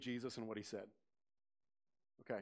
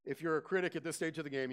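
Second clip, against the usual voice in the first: ordinary delivery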